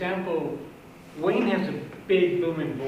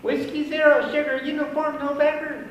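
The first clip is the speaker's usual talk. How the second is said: much higher, louder call sign; Mickey Mouse voice; 10 semitones higher, 3 dB louder than usual. Shown as a demonstration. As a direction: squeak the call sign up high. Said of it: "I'll sound like Mickey Mouse".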